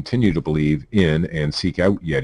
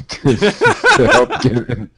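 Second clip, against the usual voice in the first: higher, cracking up mid-read; flustered laughter; 7 semitones higher, 5 dB louder, much slower than usual. Real, not acted. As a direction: try to read through helpless laughter. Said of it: "you got me all flustered".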